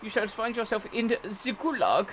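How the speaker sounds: a hammed-up German villain threat; German accent. Threatening the driver in a mock German accent. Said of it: "Wrong accent".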